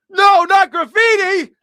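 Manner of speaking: "No, not graffiti." yelled high and loud, slow; shouting correction. Shown as a demonstration. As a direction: scream "No" and "not" in exasperation.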